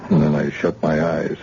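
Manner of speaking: normal talking voice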